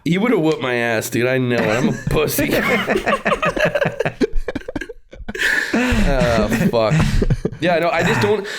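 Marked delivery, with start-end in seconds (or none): 2.6-4.9 long high-pitched giggle at himself. Real, not acted. Laughing at himself.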